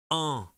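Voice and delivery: "en" as one isolated French nasal vowel; demonstrated en sound. Shown as a demonstration. Teacher showing the sounds to distinguish, "en" as a nasal vowel. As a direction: say "en" nasally, with no n consonant. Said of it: "vous allez distinguer le".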